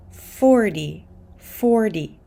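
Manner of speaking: "forty" said like "fordy"; fast D for T. Shown as a demonstration. Teacher models American "forty" with a fast D. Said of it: "because the T is after an R".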